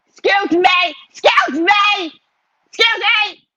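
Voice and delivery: high, loud re-enacted yelling; retold yell. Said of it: "When I yelled at that man".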